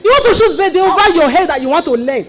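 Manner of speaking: incredulous angry big sister; scolding outburst. Acted. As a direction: tell her off loudly. Scolding sister over choice.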